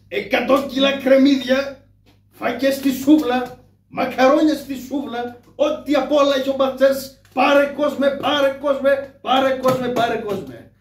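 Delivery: loud, high, slow hawker's cry; town crier call. Acted. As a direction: sell it to the whole street. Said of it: "αρχίζω".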